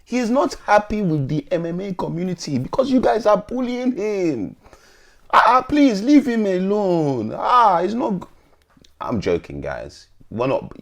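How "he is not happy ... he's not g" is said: mock pleading. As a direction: plead in a put-on high voice, dragging the words. Mock plea, then says joking.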